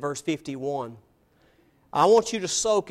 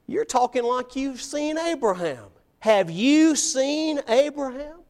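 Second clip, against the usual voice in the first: higher-pitched, disbelieving question; slow down and lift the final question in disbelief; incredulous challenge; 6 semitones higher than usual. Acted. Challengers asking Jesus if he has seen Abraham, incredulous.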